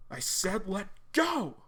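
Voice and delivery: John yelling at Sarah to let go; angry demand. Acted. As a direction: drag each word out in anger. John yells at Sarah to release.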